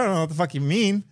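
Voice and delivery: ordinary delivery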